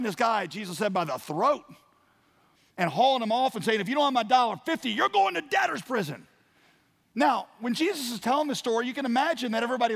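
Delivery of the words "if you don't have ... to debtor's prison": high-pitched, loud, furious outburst; angry threat. Acted. Forgiven man hauling off his buddy, acted furious threat. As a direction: shout it like an outraged creditor.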